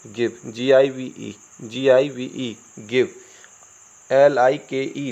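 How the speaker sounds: ordinary delivery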